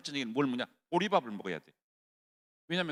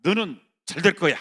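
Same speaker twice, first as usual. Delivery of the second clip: loud, fervent pep talk to oneself; loud self-encouragement; 7 semitones higher, 15 dB louder than usual. Shown as a demonstration. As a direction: shout it into the mirror, much louder. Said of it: "거울에다 대고".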